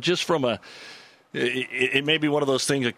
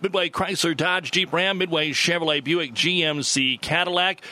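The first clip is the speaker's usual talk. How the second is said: upbeat radio sponsor read, pitched up; announcer read; 4 semitones higher than usual. Acted. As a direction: sell the dealership names like a radio host. Show intro and sponsor read.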